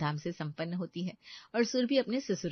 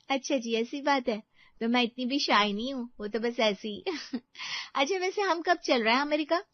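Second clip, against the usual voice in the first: higher, teasing wife's voice with a little laugh; playful new bride; 6 semitones higher than usual. Acted. Bride to husband about going to America, playfully teasing. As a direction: tease him back lightly, pitched up. Wife teases husband back.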